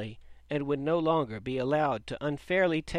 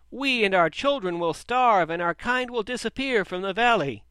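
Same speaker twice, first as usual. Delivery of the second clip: despairing wail; 7 semitones higher, 8 dB louder than usual. A narrator's reading. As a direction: cry out the loss as a lamenting crowd. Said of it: "wails of despair".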